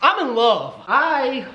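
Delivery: high-pitched swooning declaration; mock lovestruck. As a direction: gush it like a hammed-up crush. Mock swoon over a crush.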